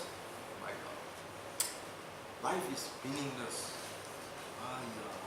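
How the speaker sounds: soft, breathy hopeless moan; despairing sigh. Retold or imitated. Depressed governor moaning to himself, hushed and hopeless. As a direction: sigh it out like life is empty.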